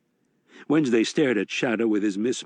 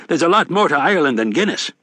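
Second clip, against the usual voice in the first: higher, brash Irish barfly; rowdy leprechaun; 6 semitones higher than usual. A narrator's reading. Mad Sweeney voiced in narration.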